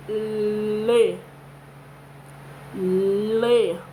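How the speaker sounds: "Le" with tongue tapping the palate; pronouncing le. Shown as a demonstration. Teacher showing children the tongue movement on le. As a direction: tap the tongue up for "Le". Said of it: "A língua vai lá em cima".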